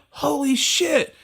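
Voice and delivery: high, drawn-out awe; imitated amazement. Club audience seeing a famous person walk in, amazed. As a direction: gasp it slowly, pitch way up, starstruck.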